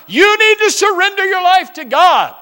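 high, loud lecturing parent; mock preachy parent. Parent lecturing child, quoted.

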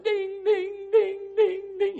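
high, ringing sing-song dings; repeated high bell chimes; imitated bell clanging. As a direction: mimic a loud dinner bell. Imitates the loud dinner bell.

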